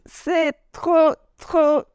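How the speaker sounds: high, slow, stretched-out gush; over-the-moon enthusiasm. Real, not acted. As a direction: draw out the praise with real delight. Enthusing about New York.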